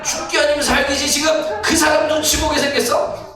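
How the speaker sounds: high, loud, surging sermon voice; fiery preaching. Acted. Pastor building the congregation to fervor, fiery preaching. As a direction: preach it with everything, rising.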